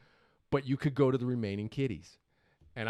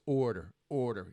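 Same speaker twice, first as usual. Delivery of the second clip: usual speaking voice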